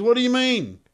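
very high, loud Texas caller; shocked Texas twang, way up; Texas accent. Retold or imitated. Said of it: "I'm doing a Texas accent".

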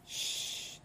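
put-on a hushed whispered shh in the Southern accent; whispered aside. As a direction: drop to a secretive whisper. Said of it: "with a heavy fucking accent".

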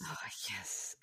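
breathy hushed aside; whisper. Real, not acted. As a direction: whisper it knowingly.

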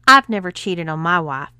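loud indignant protest. A narrator's reading. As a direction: push back hard. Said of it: "protested Bob".

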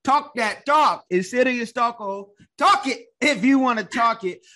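loud, high-pitched hype for the guest; emphatic encouragement. Real, not acted. Host hypes guest to talk freely.

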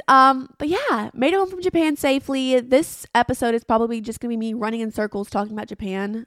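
ordinary delivery

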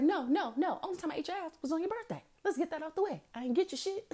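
hammed-up indignant man; mock protest. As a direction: fire back fast, denying it. Man's defensive reply quoted.

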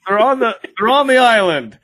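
high, loud, pleased; proud and eager; excited officials. Retold or imitated. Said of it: "they were like".

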